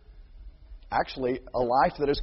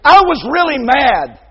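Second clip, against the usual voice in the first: louder, higher protesting excuse; 9 semitones higher, 12 dB louder than usual. Retold or imitated. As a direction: blurt it out defensively. Child excusing himself.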